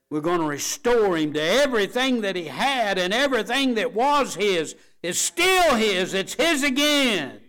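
high, loud preaching build; triumphant shout. Acted. Father restoring his son, triumphant shouting.

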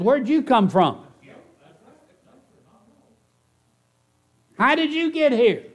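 high surprised questions; mock surprise. Preacher imitates Pharaoh's daughter, high and surprised. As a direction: sound caught off guard.

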